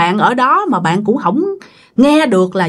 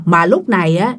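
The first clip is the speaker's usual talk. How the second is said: ordinary talk, nothing marked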